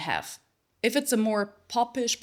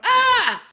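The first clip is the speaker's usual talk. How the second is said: high loud shouted ah; 10 dB louder than usual. Shown as a demonstration. Coach showing where the belt sound comes from. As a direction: shout the ah at someone. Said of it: "as if you would shout at someone".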